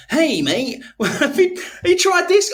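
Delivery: high, sing-song advice-giver; mock the eager helpers, rising high; chirpy know-it-all. Mates mocking the eager advice-givers: high sing-song know-it-all. Everyone offering advice, mocked.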